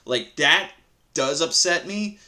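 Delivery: ordinary delivery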